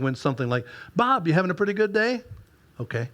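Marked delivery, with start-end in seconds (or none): 0.9-2.2 higher, lilting wife's voice; a wife calling, light and lilting. Retold or imitated. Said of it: "the lilt in her voice". 2.8-3.1 flat, lifeless and softer; husband reading the paper, dead and soft; dead reply. Retold or imitated. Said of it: "the deadness in mine".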